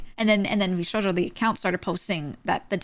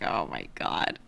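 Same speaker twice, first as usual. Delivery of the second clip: much lower, slow, deadpan groan; exasperated groan; 7 dB quieter, slower than usual. Real, not acted. Streamer reacting to fake friends, low slow groan. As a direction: drag it out low in disbelief.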